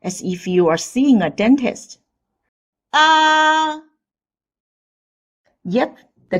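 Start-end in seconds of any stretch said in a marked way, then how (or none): 2.9-3.8 "Ah" long, high, loud and level; loud first tone. Shown as a demonstration. Patient opens up for the dentist, loud high level ah. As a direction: open wide as at the dentist for a held "Ah". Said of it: "Open your mouth wide and speak loudly".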